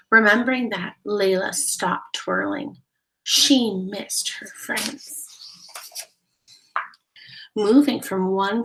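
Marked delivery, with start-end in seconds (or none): none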